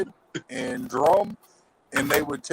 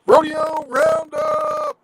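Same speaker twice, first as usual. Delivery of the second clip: announcer call; 9 semitones higher, 6 dB louder, much slower than usual. Acted. Announcing a channel shoutout.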